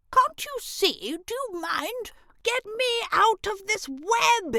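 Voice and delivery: high, small demanding voice; a tiny bossy grub stuck in a web; tiny trapped grub. A narrator's reading. Trapped grub pleads with Elray, high and tiny. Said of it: "it kept yelling".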